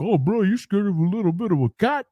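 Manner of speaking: high, taunting mock voice; mocking teaser. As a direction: tease him like a smug friend. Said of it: "people are like".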